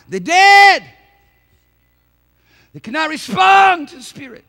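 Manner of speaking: high, loud burst of fire; preaching shout. Real, not acted. Preacher roaring at the congregation about the spiritually dead, full shout. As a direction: hit it with full preaching force.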